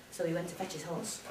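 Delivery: ordinary delivery, nothing marked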